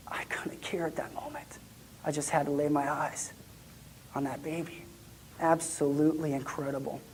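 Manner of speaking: soft, reverent hush; awed whisper. Retold or imitated. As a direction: whisper it in wonder. Gazing at the baby.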